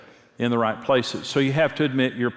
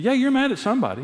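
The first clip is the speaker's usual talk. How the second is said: higher, fast, insistent and a bit louder; don't let him off the hook; insistent push; 13 semitones higher, 3 dB louder, faster than usual. Retold or imitated. Insisting against the denial.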